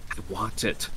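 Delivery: softly spoken request; quiet. A narrator's reading. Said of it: "she said quietly".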